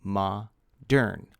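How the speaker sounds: "ma-dern" broken into two clear beats; syllables split. Shown as a demonstration. Teacher counting syllables for the class, split in two. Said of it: "Modern is two syllables".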